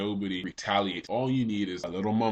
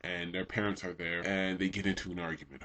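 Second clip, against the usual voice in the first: normal talking voice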